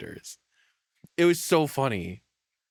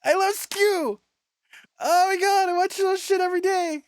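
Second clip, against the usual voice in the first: squealing, much higher, louder fan voice; over-the-top fan squeal at a favourite streamer; gushing fangirl; 3 dB louder than usual. Pretend. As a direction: shriek it like a starstruck fan.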